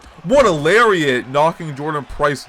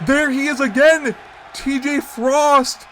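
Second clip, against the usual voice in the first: excited; 11 semitones higher than usual. Real, not acted. Surprise arrival at ringside.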